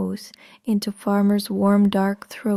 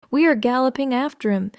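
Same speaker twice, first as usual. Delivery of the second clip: usual speaking voice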